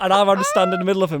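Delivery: ordinary talk, nothing marked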